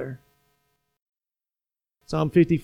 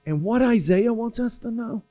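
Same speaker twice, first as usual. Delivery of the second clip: normal talking voice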